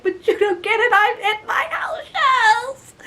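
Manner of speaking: very high, mock-crying wail; whiny wail. Woman complaining to security guard, mock-tearful wail. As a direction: whine it high like a tearful child, stretching the end.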